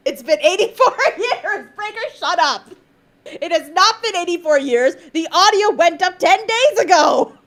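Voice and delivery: high-pitched, laughing indignation; mock outrage. Real, not acted. Streamer telling off Breaker in chat, mock outrage. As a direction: scold the exaggerator in amused exasperation. Mock-arguing with chat's exaggerated complaint.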